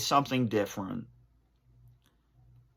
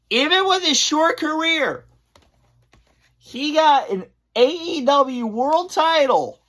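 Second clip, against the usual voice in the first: choked up; 11 semitones higher than usual. Real, not acted. Choked up about wrestler's passing.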